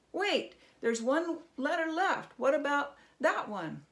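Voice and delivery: high, loud goblin question. Acted. Goblin interrupts Herschel about the last letter, high and loud. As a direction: pipe up like an impatient little goblin.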